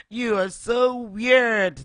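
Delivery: high, loud, slow mocking taunt; mocking chickens. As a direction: sneer it like a playground bully. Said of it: "they said".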